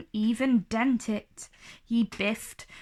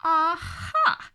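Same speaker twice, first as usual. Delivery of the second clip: a dragon's cry, higher and odd-timbred; 7 semitones higher, 4 dB louder than usual. A narrator's reading. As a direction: pounce on it as the dragon. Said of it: "he cried".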